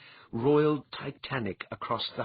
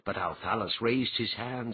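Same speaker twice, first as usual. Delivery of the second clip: ordinary delivery, nothing marked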